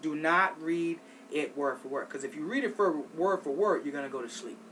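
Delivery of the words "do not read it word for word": normal talking voice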